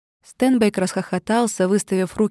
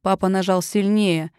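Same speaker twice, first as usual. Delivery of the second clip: ordinary delivery, nothing marked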